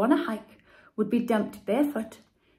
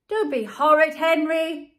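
scream; 6 semitones higher, 7 dB louder than usual. A narrator's reading. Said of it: "screamed Mum".

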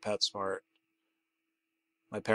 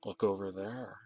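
soft, rasping stranger's voice; a hoarse anonymous caller, kept low; 3 semitones lower, 11 dB quieter than usual. Acted. Anonymous caller directing him, soft and hoarse. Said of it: "a hoarse, anonymous voice".

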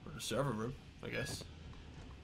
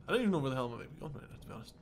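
ordinary talk, nothing marked

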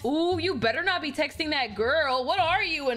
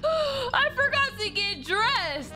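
gasp of panic; 8 semitones higher, slower than usual. Acted. Sudden realization with gasp.

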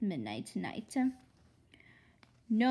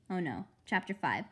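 ordinary delivery, nothing marked

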